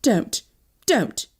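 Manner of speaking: usual speaking voice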